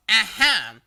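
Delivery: sudden loud triumphant exclamation; abrupt aha. Retold or imitated. Karen to narrator tapping shoulder, abrupt loud aha. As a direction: pounce on her with it. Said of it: "startled upon an abrupt".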